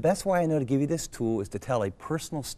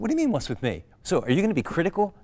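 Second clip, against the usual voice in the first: higher, louder, defensive snap; irritated retort; 7 semitones higher, 4 dB louder, faster than usual. Retold or imitated. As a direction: bristle back at her, offended. He snaps back at wife.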